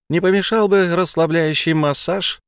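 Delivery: sweet sly pixie offer. A narrator's reading. Fairy blonde speaks.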